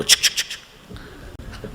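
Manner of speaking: soft slow unvoiced chik chik sounds; mock shushing noise to wake him; whispered chik noise. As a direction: breathe out a slow hushed chik chik. Shaking awake sound effect.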